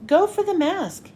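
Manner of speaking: high urgent call to the team; yelled order. A narrator's reading. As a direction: shout it across the fight. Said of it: "Hero yelled".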